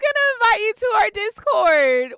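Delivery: high, loud tearful outcry; sobbing disbelief; crying shout. Real, not acted. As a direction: shout through sobs in disbelief.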